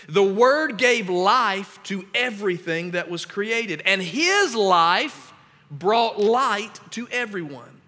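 slow, weighty scripture read. Acted. Pastor reading John aloud to the church, slow and solemn. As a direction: proclaim it slowly.

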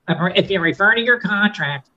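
pinched nasal clothespin-on-nose voice. Shown as a demonstration. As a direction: say it with your nose held shut. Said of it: "with a clothespin on my nose".

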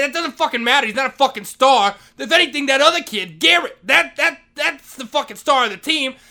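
heated yell. Retold or imitated. Janitor arguing about the real star, heated yell. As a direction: shout him down. Retold shouting match with principal.

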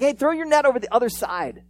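acted shout from shore; calling out. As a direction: holler an instruction to a distant boat. Jesus calls to boat from shore.

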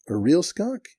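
high, rising disbelief; incredulous question. Retold or imitated. Manager double-checks what Cotton means, high and incredulous. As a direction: ask it with pitch jumping up, incredulous.

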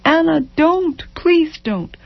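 high, anguished entreaty; broken pleading. A narrator's reading. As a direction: beg with the voice breaking. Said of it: "his broken".

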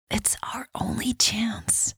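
breathy whisper, slow. Acted. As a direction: whisper it so the guard can't hear. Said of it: "Michelle whispered".

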